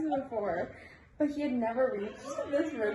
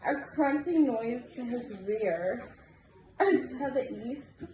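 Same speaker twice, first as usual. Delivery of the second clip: ordinary delivery, nothing marked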